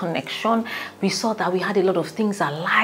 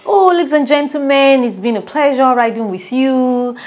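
higher, louder on-air host voice; radio presenter; 6 semitones higher, 6 dB louder than usual. Retold or imitated. The presenter closing his radio show: higher, louder host voice. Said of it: "presenter said".

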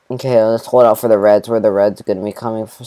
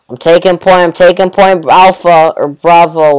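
barked higher and louder, like a squad leader; mock soldier callout; 5 semitones higher, 6 dB louder than usual. Gamer playing soldier to his viewers, barking the callout. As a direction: play the eager soldier taking point.

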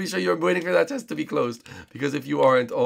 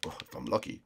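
a lower, much softer villain aside; Bowser voice; 5 semitones lower, 11 dB quieter than usual. Acted. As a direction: slip the line under the breath as Bowser.

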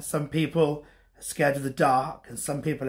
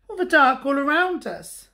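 high, plaintive cub voice; Little Bear; 10 semitones higher, 4 dB louder than usual. A narrator's reading. Little Bear answers Big Bear about the dark, high cub voice. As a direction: explain it high like a worried cub.

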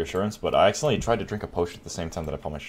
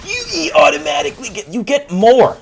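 high, loud lecturing mock voice; hammed-up know-it-all shouting; 7 semitones higher, 6 dB louder than usual. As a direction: imitate commenters explaining it loudly. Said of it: "And people are like".

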